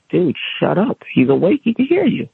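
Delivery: rising, urgent warning to a colleague; doctor warning his colleague in a high urgent voice; urgent hush. Retold or imitated. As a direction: hiss it up high, he can hear. Doctor hushing colleague, patient awake.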